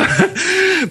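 high-pitched laugh; short, high burst of laughter. Real, not acted. Laughs between his own remarks.

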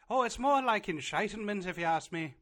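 loud, high-pitched mock Irish accent. Host mocking New Age shamans, exaggerated Irish accent. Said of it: "but in an Irish accent".

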